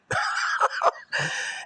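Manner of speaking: short burst of breathy, voiceless laughter. Real, not acted. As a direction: laugh it off after the complaint. Bitter remark about missed birthday.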